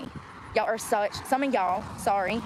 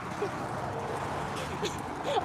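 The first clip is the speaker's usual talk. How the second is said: breathy giggle; 10 semitones higher, 7 dB quieter than usual. Real, not acted. Laughing at own bit.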